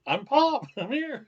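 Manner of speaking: loud, high comic call-out; hammed-up announcement. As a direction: announce yourself to the whole room. Acted announcing himself in a restaurant.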